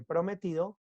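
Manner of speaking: normal talking voice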